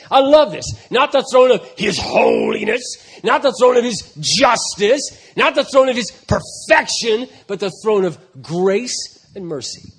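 loud, building pulpit reading; rising, ringing sermon voice; full-force preaching. Acted. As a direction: proclaim it to the back row.

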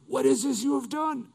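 high, strained cry of dismay; exceedingly afraid. Acted. Terrified sailors to Jonah, high frightened question. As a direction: blurt it in acted alarm. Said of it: "the men were exceedingly afraid".

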